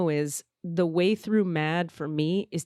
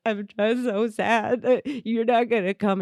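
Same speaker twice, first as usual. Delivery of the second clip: mock-tearful, higher and slow; imitated crying; 4 semitones higher than usual. Calling him from her car while crying, tearful imitation. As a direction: whimper it as if through tears. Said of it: "So I call him while crying".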